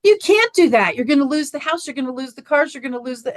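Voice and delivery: panicked son talking his mother out of quitting; alarmed protest. Retold or imitated. Son alarmed she'll quit job.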